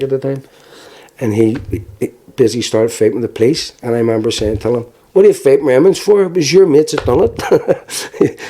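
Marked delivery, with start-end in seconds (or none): 5.1-8.4 high-pitched, exasperated mock protest; retold scolding. Injured son to his drunk dad fighting police, exasperated. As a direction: imitate a son telling off his father, pitched up.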